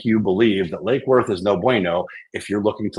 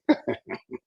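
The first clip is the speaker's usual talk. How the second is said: high giggle; 6 dB quieter than usual. Real, not acted. Laughs at himself.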